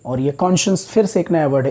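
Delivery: ordinary delivery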